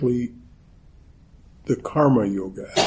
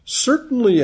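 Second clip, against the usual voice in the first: a much higher, lightly voiced scarecrow reply; the scarecrow answering the girl, higher; 12 semitones higher, 3 dB louder than usual. A narrator's reading. Scarecrow's first reply, later reread.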